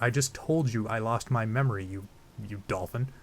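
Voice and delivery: usual speaking voice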